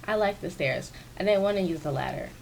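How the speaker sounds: ordinary talk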